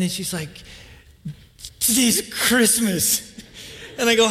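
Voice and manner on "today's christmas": higher, drawn-out announcement; excited child. Retold or imitated. Daughter telling dad it's Christmas morning, excited. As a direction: lightly imitate a child's drawn-out Christmas glee.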